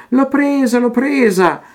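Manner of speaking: high excited child's cry, mock shouted; gleeful cry. Teresa crying to herself over the caught butterfly, high and gleeful. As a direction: squeal it like a girl catching a butterfly. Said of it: "gridava distesa".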